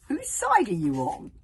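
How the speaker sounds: loud and slow, pressing for an answer; demanding. Acted. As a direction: demand to know his side, slowly.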